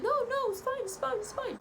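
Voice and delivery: mock him pretending all is fine; high mock-nervous it's fine; nervous reassurance. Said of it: "He's like".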